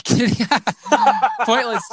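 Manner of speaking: laughing through the words. Real, not acted. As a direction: giggle at own slip of the tongue. Laughs at own slip.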